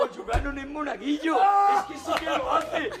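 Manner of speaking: high astonished yell; amazed shout. Real, not acted. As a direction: shriek in disbelief. (grito de asombro)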